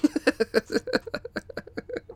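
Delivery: high, long cackling laugh; uncontrollable laughter. A narrator's reading. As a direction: laugh helplessly. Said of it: "Jan begins laughing uncontrollably".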